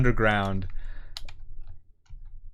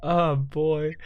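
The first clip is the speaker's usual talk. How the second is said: giggling through the words; savoring the words with a laugh; 9 semitones higher, much slower than usual. Real, not acted. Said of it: "I love saying the words".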